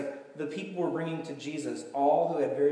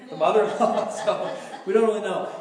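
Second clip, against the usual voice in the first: louder, amused, laughing through words; laughing aside; 4 semitones higher, 7 dB louder than usual. Real, not acted. Preacher joking about Peter's mother-in-law, laughing. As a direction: let real laughter break into the aside.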